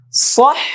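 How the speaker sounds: صح slow, with the ح breathed and held; sustained h sound. Shown as a demonstration. Teacher models صح, holding the h slowly. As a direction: stretch the ح in صح, keep it floating. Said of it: "হায়ের আওয়াজটা ভাসিয়ে রাখতে হবে".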